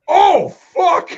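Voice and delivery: high, loud shocked cry. Retold or imitated. Mike seeing the disgusting stall, loud shocked outburst. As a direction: recoil at the sight. Mike sees the clogged toilet.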